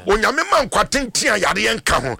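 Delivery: fervent prayer. Real, not acted. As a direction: proclaim the blessing with conviction. Prays for a caller on air.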